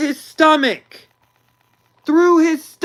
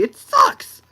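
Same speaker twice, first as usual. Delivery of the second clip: squealed complaint; 3 dB louder than usual. Acted. Ranting about the movie.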